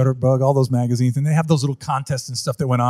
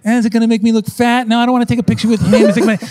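mock fussy bride; 7 semitones higher, 4 dB louder than usual. Mocking wedding clients' worries.

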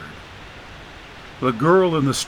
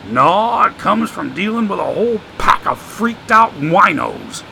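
put-on higher, sly voice of an old drifter; streetwise homeless man; 6 semitones higher, 4 dB louder than usual. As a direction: play the wisecracking drifter, amused. Joshua's voice in the story.

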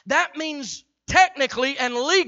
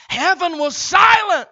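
heaven was silent belted out loud and high; preached shout; 5 semitones higher, 5 dB louder than usual. Acted. Preacher building to a climax for the congregation, shouted. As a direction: cry it out to the congregation like a revelation.

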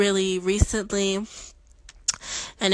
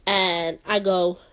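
ordinary talk

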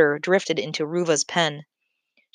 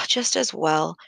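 sighing; slower than usual. A narrator's reading. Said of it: "she sighed".

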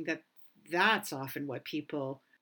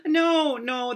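high, loud laughing no; 12 semitones higher, 13 dB louder than usual. Real, not acted. Laughing along with host.

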